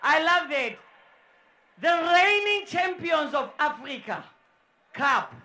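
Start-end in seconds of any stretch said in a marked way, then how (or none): none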